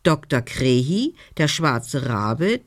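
ordinary delivery

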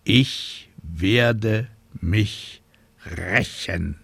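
Ich werde mich rächen, every syllable slow and stressed; sounding out words. A narrator's reading. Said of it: "Silbe für Silbe betonend".

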